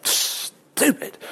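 breathy whispered put-down; whispered aside. Real, not acted. Preacher whispers his judgment on drinking to the congregation. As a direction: drop to a whisper for the verdict.

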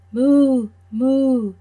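loud, high playful moo; mooing cow. Acted. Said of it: "The cow said".

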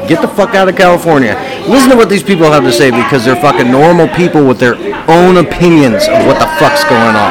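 loud, fast, high hype; excited hype. Real, not acted. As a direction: pump up the crowd. Host hypes podcast loudly.

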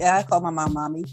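normal talking voice

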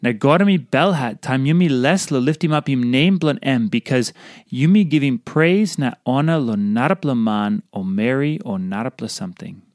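normal talking voice

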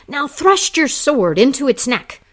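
loud, high battle cry. Acted. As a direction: shout it over the struggling bull. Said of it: "he cried".

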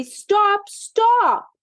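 high pleading stop, stop; high cry of stop. A narrator's reading. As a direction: call out to stop the poodles. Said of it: "cried Daisy".

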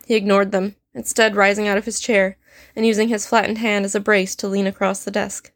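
plain reading voice

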